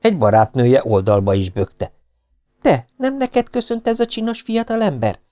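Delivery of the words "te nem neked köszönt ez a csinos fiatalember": put-on teasing girlfriend. A narrator's reading. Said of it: "kérdezte".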